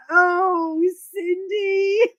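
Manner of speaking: high, loud, laughing repeated cry; laughing disbelief. Retold or imitated. Reacting to a friend's loo live idea, laughing high. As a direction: squeal through laughter in mock horror.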